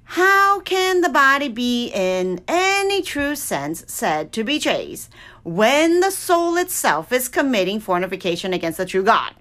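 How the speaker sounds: usual speaking voice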